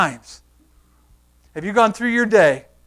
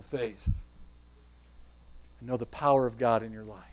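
very soft, low preaching with long pauses; low, subdued, solemn closing appeal; hushed altar appeal; 7 semitones lower, 12 dB quieter than usual. Acted. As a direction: quietly invite the congregation to respond, leaving space between phrases.

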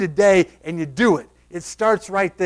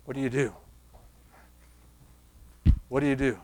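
low, soft questions with long pauses; subdued, low-pitched sermon delivery; hushed preaching; 7 semitones lower, 7 dB quieter than usual. Acted. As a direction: quietly put searching questions to the congregation.

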